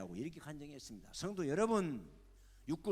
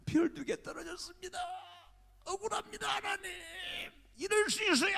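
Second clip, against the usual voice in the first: high, loud wailing cries to God; crying prayer; 11 semitones higher, 9 dB louder than usual. Retold or imitated. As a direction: sob and shout your injustice. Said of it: "하고 엉엉 울면서".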